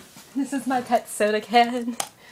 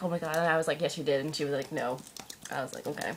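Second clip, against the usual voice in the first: low, soft deadpan exchange; hushed retelling; 9 semitones lower, 11 dB quieter, much faster than usual. Retold or imitated. Retelling an exchange with a friend, low and hushed. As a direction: drop both voices to a low undertone.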